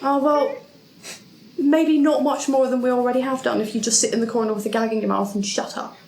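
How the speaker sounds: ordinary talk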